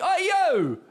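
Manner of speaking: high, loud street-hawker yell; hammed-up loud street pitch; mock preacher shout. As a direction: shout it like a pushy street preacher selling fitness. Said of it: "you got to be shouting at me".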